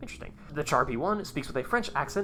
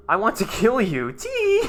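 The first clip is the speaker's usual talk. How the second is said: loud, leaping into a high squeal; gleeful threat ending in a piercing titter; giggling maniac; 9 semitones higher, 8 dB louder, slower than usual. Acted. Acting a giggling killer tank.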